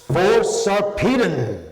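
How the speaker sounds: rally the army with a long roar; battle cry. A narrator's reading. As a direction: Hector leads the charge with a long, high, loud battle cry.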